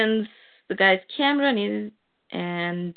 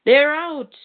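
thug calling out; 7 semitones higher, 6 dB louder than usual. Retold or imitated. Thugs calling out.

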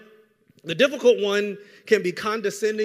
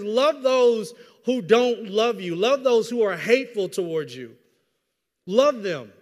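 slow, loud deliberate scripture; slow emphatic reading; 4 dB louder, slower than usual. Shown as a demonstration. Preacher reading the verse aloud to the church, slow and emphatic. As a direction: lean on every word of it. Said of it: "It says".

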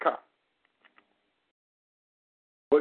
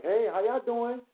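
slow, higher, sing-song fake politeness; mock-friendly greeting; 5 semitones higher, slower than usual. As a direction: greet them sweetly but slowly, with a sly edge. Kids mockingly greet the cops.

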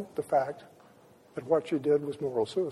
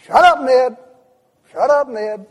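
higher, loud playful shut up; teasing shout; 8 semitones higher, 11 dB louder than usual. Retold or imitated. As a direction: brush him off loudly and fondly. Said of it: "And she says".

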